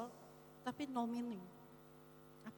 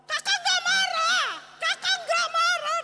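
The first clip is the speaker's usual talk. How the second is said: much higher, much louder and slow furious yell; angry denial shout; 14 dB louder than usual. Shown as a demonstration. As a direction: bellow that you're not angry while clearly furious. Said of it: "gimana gak kelihatan marah".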